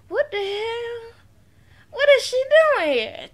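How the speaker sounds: high-pitched, hammed-up incredulous aside; mock disbelief. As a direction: imitate stunned onlookers in a high voice. Acting out onlookers' disbelief.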